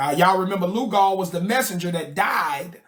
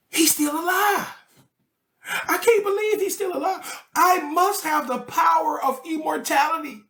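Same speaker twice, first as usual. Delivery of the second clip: much higher, crying amazement; astonished disbelief; 7 semitones higher than usual. Retold or imitated. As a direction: gasp out the wonder that he lived. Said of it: "she said".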